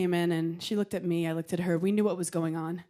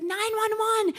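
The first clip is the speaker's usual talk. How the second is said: high frantic cry of nine one one; panicked waitress; 12 semitones higher, slower than usual. Retold or imitated. Waitress freaking out at the table, high panicked shriek.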